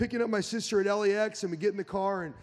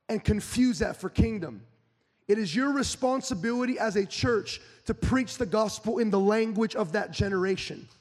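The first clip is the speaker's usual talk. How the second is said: louder, driving preacher's cadence, building up; full-force preaching; 4 semitones higher, 6 dB louder than usual. Acted. Pastor urging the church to change its delivery, preaching at full force. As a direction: preach it out hard to the congregation.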